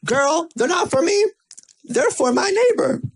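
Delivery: put-on high, sassy "girl" voice of a friend.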